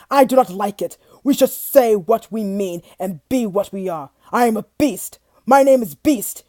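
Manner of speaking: loud, angry monster voice; beast raging. Acted. Beast furiously declaring his name, loud. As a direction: hammer each word home.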